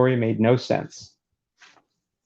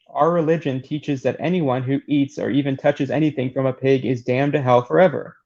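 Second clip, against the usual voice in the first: normal talking voice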